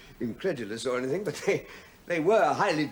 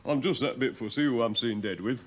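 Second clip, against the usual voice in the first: put-on Yorkshire accent. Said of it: "uncompromising Yorkshire accent".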